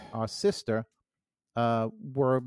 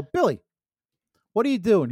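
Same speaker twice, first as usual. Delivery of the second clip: retold exasperated call to a kid brother; 6 semitones higher, 6 dB louder than usual. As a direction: call out louder and higher, mock exasperation. Older brother interrupts kid brother's chatter.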